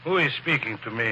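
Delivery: put-on European count accent; fake European accent. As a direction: hide behind a phony European accent. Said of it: "forget your accent".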